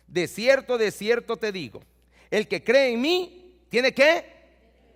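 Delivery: loud, driving sermon delivery; fervent preaching. Acted. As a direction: preach it with fire to the congregation. Preaching at full force.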